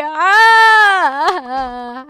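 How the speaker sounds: put-on crying that dies away; fake crying. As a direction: ham up the sobs to win pity. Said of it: "我要哭起来了".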